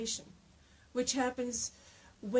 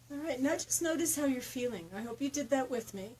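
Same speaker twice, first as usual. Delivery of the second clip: normal talking voice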